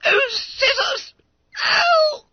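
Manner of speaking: very high, loud squeals; flabbergasted. Acted. Fat schoolboy staring at unexpected money, flabbergasted. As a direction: squeak each exclamation in stunned disbelief. Bunter stunned by two postal orders.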